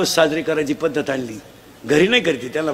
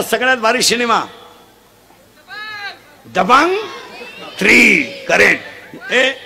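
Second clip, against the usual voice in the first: full-force preaching; 6 semitones higher than usual. Acted. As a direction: drive it home to the crowd. Quizzing the crowd loudly.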